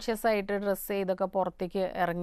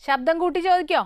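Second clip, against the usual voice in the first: loud, raised-pitch request; calling out to caller; 8 semitones higher, 7 dB louder than usual. Real, not acted. Host asking a caller to speak up, calling out. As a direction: project so the caller hears you.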